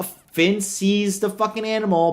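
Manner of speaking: normal talking voice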